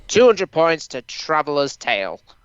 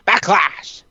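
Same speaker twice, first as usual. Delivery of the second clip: booming, higher announcer-style shout; Vince McMahon; 4 semitones higher, 3 dB louder than usual. Acted. Host naming the wrestling event, mock McMahon bellow. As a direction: belt it out as an impersonation. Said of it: "that's my Vince McMahon impersonation".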